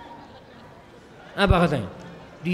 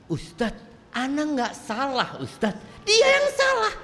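mock complaining wife; 9 semitones higher, slower than usual. Kadangkala istri ngomong.

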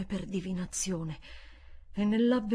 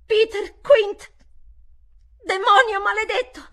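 anguished scream; 13 semitones higher, 12 dB louder than usual. Acted. Miles screaming the ghost's name, anguished. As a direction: howl the name and curse.

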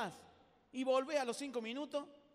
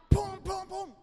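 hammer blows; 8 semitones higher, 10 dB louder, much slower than usual. Retold or imitated.

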